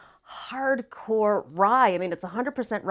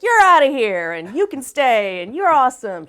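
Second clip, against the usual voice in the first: bossy moderator voice; 4 semitones higher, 9 dB louder than usual. Retold or imitated. Voicing the chat moderator.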